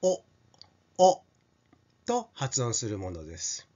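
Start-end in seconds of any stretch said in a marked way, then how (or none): 0.0-1.2 お said with the mouth opened wide. Shown as a demonstration. Teacher demonstrating the open お vowel. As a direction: drop the jaw for a wide お. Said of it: "もう少し口を大きく開けて".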